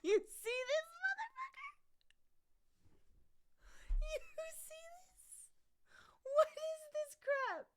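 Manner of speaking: very high, squeaky indignant squeal; high-pitched exasperated cat dad; mock outrage. Real, not acted. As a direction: squeal it in disbelief, stretching the words. Mock outrage at cat's misbehavior.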